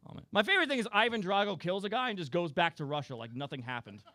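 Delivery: ordinary delivery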